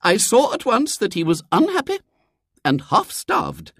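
very high, indignant lady's voice; accusing lady. A narrator's reading. Visitor accuses William's father, indignant.

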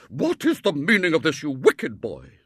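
high, loud stern rebuke; angry scolding. A narrator's reading. Headmistress grabbing the boy, loud angry scolding.

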